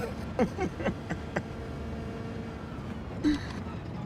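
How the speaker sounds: long, very high, airy squeal of laughter; real laugh; breathless wheeze, cracking up; wheezing laugh. Real, not acted. Picturing the man at darts.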